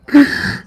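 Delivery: high-pitched giggle; short, high burst of laughter. Real, not acted. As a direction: crack up in a high voice. Laughs at own outburst.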